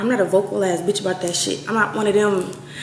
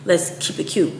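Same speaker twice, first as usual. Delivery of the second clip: whispered aside; 4 semitones lower, 6 dB quieter than usual. Real, not acted. Whispered aside after laugh.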